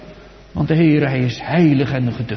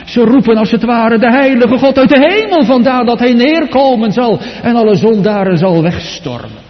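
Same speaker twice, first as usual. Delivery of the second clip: higher and louder, thundering pulpit cry; fiery sermon peak, sins crying to heaven; preaching at full force; 8 semitones higher, 7 dB louder than usual. Acted. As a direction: cry it out to the congregation like a warning of judgement. Preacher builds to loud climax.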